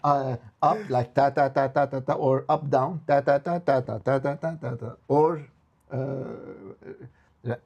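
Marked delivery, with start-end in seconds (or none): 0.0-5.0 a quick vocal sketch of the missing mode. Shown as a demonstration. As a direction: sing the pattern going up then down. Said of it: "There should be up ... or up, down".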